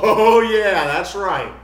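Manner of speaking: loud drawn-out "oh" of mock agreement; knowing congregation. Preacher voicing his long-time congregation, mock knowing agreement. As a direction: imitate listeners heartily agreeing. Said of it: "you're gonna be sitting there going".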